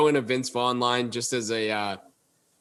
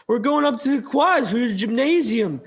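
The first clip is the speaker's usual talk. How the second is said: put-on drunk streaker; 10 semitones higher than usual. Will Ferrell streaking, announcing plans.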